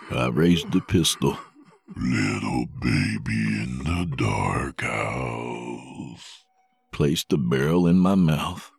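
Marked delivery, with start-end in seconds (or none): 2.0-6.4 slow, low, creepy murmur; creepy slow taunt. Acted. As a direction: croon it like a menacing lullaby. Corso's voice in his head.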